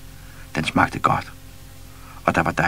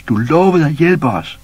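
drawn out, higher and louder reproach; slower, louder shout; 9 semitones higher, 7 dB louder than usual. A narrator's reading. Baboon shouts again, slower and more insistent. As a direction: stretch it out, insisting. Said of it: "råbte".

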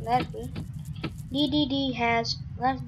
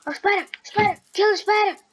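screaming at a spider; 8 semitones higher, 4 dB louder, much faster than usual. Real, not acted. Spotting a spider in game.

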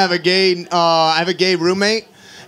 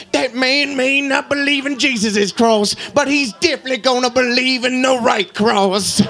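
put-on slow, higher old man promising a punch; tough old man; 3 semitones higher, slower than usual. Old man defends the preacher, slow tough warning. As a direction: lean in like a grizzled old tough guy.

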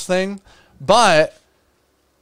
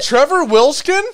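excited name call; 10 semitones higher, 5 dB louder than usual. Real, not acted. Excited about a shirt idea.

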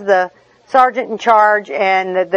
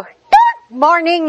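high, slow, sugary good morning; perky flight attendant; 6 semitones higher, much slower than usual. Retold or imitated. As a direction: draw out the greeting with chirpy cheer. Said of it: "a perky flight attendant".

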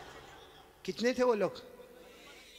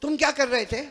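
तुम क्या कर रहे थे asked louder, with क्या lifted; louder repeat; 5 semitones higher, 8 dB louder, faster than usual. Shown as a demonstration. Preacher repeats the prophet's question louder to the crowd. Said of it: "उनको पूछा".

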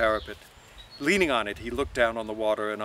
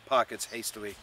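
ordinary delivery

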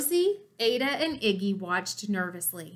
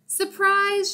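high, loud party shout; spring the surprise on her; yelled surprise; 10 semitones higher, 4 dB louder than usual. A narrator's reading. Friends surprising June, excited high yell. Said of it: "yelled the Riveters".

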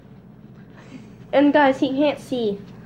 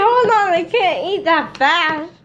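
mock protest; 4 semitones higher than usual. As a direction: call out a playful objection, starting high. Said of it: "I'm like".

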